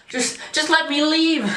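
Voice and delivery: high, frantic plea to leave; a desperate high plea; begging. Acted. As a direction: plead to be let go.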